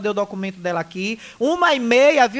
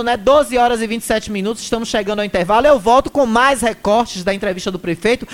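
usual speaking voice

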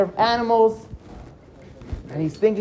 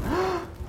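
quick, frightened breath in; startled gasp; 12 semitones higher, 11 dB quieter than usual. A narrator's reading. Narrator hearing a door open behind, startled gasp. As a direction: catch your breath in alarm.

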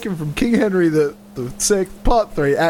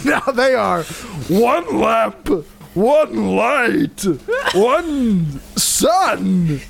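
high, hammed-up Muppet voice; comic Muppet recitation, phrase by phrase; Animal; 5 semitones higher, much slower than usual. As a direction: declaim each phrase like a silly Muppet. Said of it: "You sound like Animal".

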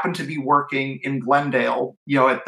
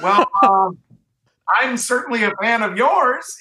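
laughing; 8 semitones higher than usual. Retold or imitated. Laughing at the famous caller.

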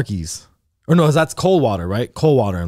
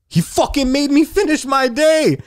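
high-pitched, incredulous rant; aggrieved, strained-high complaint; outraged; 13 semitones higher than usual. Real, not acted. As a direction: pitch way up in comic indignation. Outraged at finishing shift after robbery.

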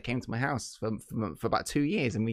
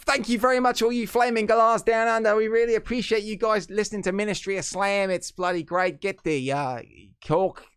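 high-pitched mock Aussie; terrible Australian accent; 7 semitones higher than usual. Podcast host thanking Australian listeners, in a terrible Australian accent. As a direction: thank them in a badly faked Australian accent. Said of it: "my terrible Australian accent".

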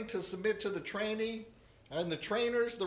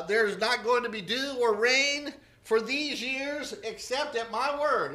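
prophet's stern warning; 4 semitones higher, 6 dB louder than usual. Retold or imitated. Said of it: "he told Ahab".

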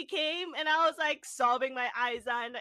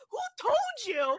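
high, slow, incredulous; stunned disbelief; 10 semitones higher than usual. Retold or imitated. Student asking the teachers who told them, stunned. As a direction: ask it like you can't believe anyone knew. Shocked teens at the airport.

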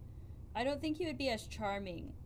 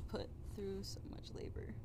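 breathy whisper; 8 dB quieter than usual. Real, not acted. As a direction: whisper the end of the thought. Whispered aside.

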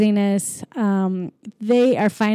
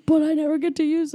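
higher, pleading little voice; whiny kid alien; 8 semitones higher, faster than usual. Retold or imitated. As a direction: whine about losing the toy. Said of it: "And he's like".

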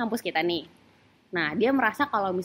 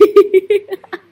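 loud laugh; 9 semitones higher, 13 dB louder than usual. Real, not acted. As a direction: burst of high loud laughter. Laughing at cohost's sad greeting.

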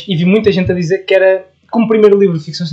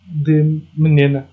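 slow, drawn out de menina; slowly spelled out; 2 semitones lower, much slower than usual. Shown as a demonstration. Host explains where [name] comes from, slow drawn-out menina. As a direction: stretch out menina to show the name.

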